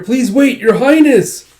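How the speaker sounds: high, loud cry after her; high, loud voiced calling out. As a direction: shout after the runaway princess. Jiro calls after the fleeing princess.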